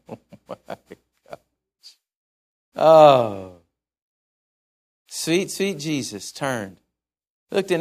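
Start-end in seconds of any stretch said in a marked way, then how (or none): 0.1-3.6 breathy, hushed, then a sudden loud "Oh."; overwhelmed. Real, not acted. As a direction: let the feeling catch you. Preacher moved by Jesus' look.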